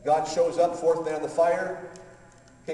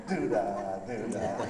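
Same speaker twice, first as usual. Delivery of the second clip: teasing singsong; 4 semitones lower, much slower than usual. Retold or imitated. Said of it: "God's up there laughing".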